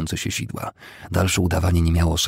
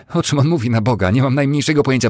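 high, loud protest; indignant denial; 8 semitones higher, 6 dB louder, faster than usual. A narrator's reading. Cornered woman denying she knows anything, indignant and loud. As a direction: protest loudly as if wrongly accused.

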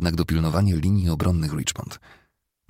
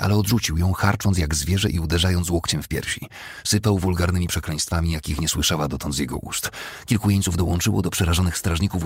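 usual speaking voice

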